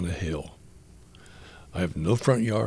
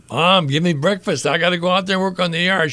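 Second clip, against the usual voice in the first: eager shout, higher and louder; yelling to mom; 7 semitones higher, 6 dB louder than usual. Retold or imitated. Eager boy shouting to his mom for breakfast, loud call. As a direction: call out across the house, fired up to start. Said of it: "And I yelled out".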